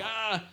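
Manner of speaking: high-pitched "ah" of pain; mock pain cry. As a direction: imitate yelping as the chest is stretched. Retold pain at stretched chest.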